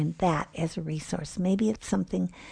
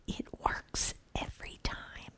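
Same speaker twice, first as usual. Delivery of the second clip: much softer secret whisper; 15 dB quieter than usual. Acted. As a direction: breathe it as a conspiratorial aside. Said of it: "Santa whispered to the clone".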